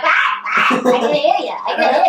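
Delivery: high, loud hard-of-hearing act; old person voice. Pretend. Pretending in forty years not to hear, loud old person voice. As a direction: call out like a batty old person. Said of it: "Is that your old person voice?".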